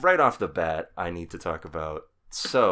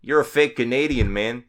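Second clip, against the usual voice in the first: put-on Canadian accent; 4 semitones higher, 6 dB louder than usual. Mock Canadian teasing.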